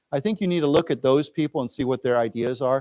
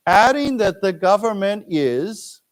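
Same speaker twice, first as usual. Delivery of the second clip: slow model reading, higher pitch; slow read-aloud; 5 semitones higher, 3 dB louder, slower than usual. Shown as a demonstration. Teacher reads line for students to repeat, slow. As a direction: read it slowly for the class to echo. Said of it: "let's read this".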